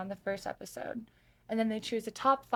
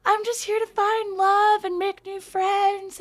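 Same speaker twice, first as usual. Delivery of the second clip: high nervous server voice; 12 semitones higher, 9 dB louder than usual. Retold or imitated. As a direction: squeak out an intro in a nervous server voice. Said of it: "I talk really high pitched, my little server voice".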